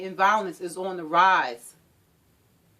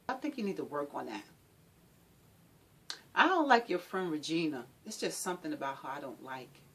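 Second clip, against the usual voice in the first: controlling partner; 3 semitones higher than usual. Acted. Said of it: "domestic violence is subtle".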